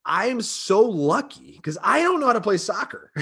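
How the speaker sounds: high, breaking into a laugh; bragging, tickled with himself; gushing proud dad. Retold or imitated. Soccer dad marvelling at his kids, high and laughing. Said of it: "And I go".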